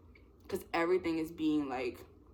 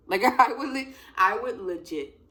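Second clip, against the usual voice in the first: loud, laughing gush; giddy and loud; laughing excitement; 5 semitones higher, 9 dB louder than usual. Real, not acted. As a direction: burst out laughing as you gush. Laughing at own fan excitement.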